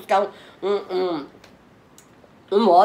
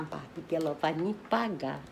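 usual speaking voice

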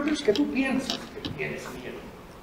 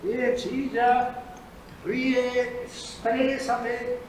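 drawn-out coaxing words; very slow; much slower than usual. Acted. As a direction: stretch each word slowly. Therapist drawing out words.

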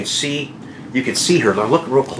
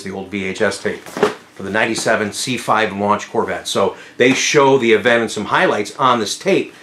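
ordinary talk

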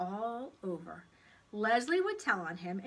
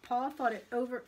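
ordinary delivery, nothing marked

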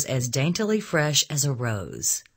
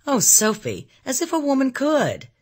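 high, loud-starting shocked protest; 12 semitones higher, 4 dB louder than usual. A narrator's reading. Anne reproaching Sophie for the idea, shocked. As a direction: exclaim in scandalised reproach, pitched well up. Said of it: "Anne's shocked eyes condemned me".

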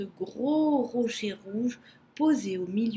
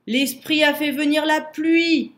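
loud, higher cheer; cheering wallaby; 5 semitones higher, 7 dB louder than usual. A narrator's reading. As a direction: celebrate it out loud, voice lifted. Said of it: "applaudit le wallaby".

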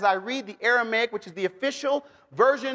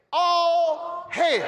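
high, drawn-out hammed-up cry; mock heraldic call to a crowd; mock proclamation; 7 semitones higher than usual. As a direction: proclaim it grandly, holding each word. Mock proclamation of King James wording.

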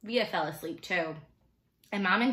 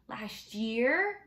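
higher, incredulous rising question; taken aback; 5 semitones higher than usual. A narrator's reading. Boy learning of parents' school tour, taken aback. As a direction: echo it back in rising disbelief.

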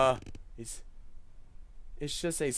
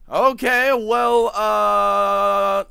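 startled reaction; 8 semitones higher, 10 dB louder, much slower than usual. Real, not acted. Reacting to the sudden ending.

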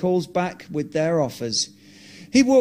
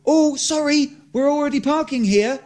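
mock nice; 8 semitones higher than usual. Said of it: "I said nicely".

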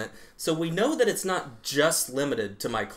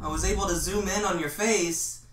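higher, eager creepy flirting; creepy flirty; 4 semitones higher than usual. Acted. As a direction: lay on the awkward charm. Sketch, flirting with a ghost.

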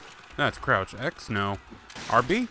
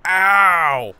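loud, higher yelp of pain; drawn-out, hammed-up "ow"; mock pain cry; 4 semitones higher, 8 dB louder than usual. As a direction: yelp as if the game hurt you. Player hurt in game, cries out.

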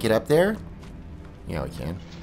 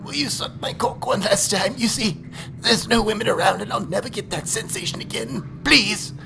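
mock pleading; 11 semitones higher than usual.

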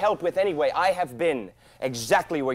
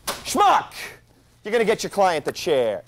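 angry outburst; 3 semitones higher, 7 dB louder than usual. Acted. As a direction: spit the insult at the dead line. Insults caller after hanging up.

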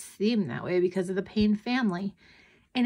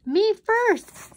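put-on very high, loud little-girl cry; demanding child; 14 semitones higher, 6 dB louder than usual. A narrator's reading. Twin competing for the hamster, demanding. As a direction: butt in, pitched way up.

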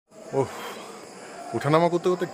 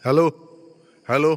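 loud hello echoing in a cave; 6 dB louder, faster than usual. Real, not acted. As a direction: call out to test the echo. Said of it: "sound ইকো হচ্ছে পুরো গুহা".

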